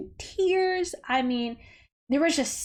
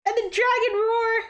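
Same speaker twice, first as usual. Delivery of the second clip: thrilled shout; 12 semitones higher, slower than usual. Real, not acted. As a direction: yell out, overwhelmed with joy. Thrilled by the dragon roar.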